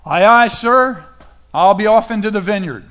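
mock eager obedience. Second son promising his father he will work, mock eager. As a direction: play the dutiful recruit reporting loudly.

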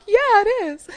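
gleeful reassurance; beaming answer to the amazed girl; excited yes. Retold or imitated. Affirming excitedly.

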